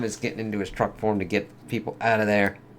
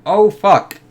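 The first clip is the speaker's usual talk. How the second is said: much higher and louder shocked yelp; startled curse; 11 semitones higher, 11 dB louder than usual. Real, not acted. Viewer yelping at a crash, high and loud. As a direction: blurt it out in alarm. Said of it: "It's like".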